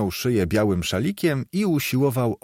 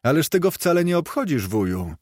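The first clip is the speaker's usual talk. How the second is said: high, quick protest, dropping at the end; 6 semitones higher, faster than usual. A narrator's reading. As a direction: object quickly in higher pitch. Nephew protests to his uncle. (szybki, wysoki protest)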